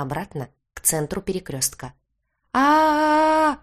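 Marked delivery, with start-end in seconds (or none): 2.5-3.6 long, sustained high scream; loud held shriek; full-lung yell. A narrator's reading. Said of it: "завопила я во все лёгкие".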